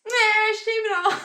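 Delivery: loud, high, hammed-up refusal; exaggerated high-pitched veto; mock dismissal. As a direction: blurt it out in playful defiance. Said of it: "And I'd be like".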